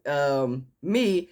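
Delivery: ordinary talk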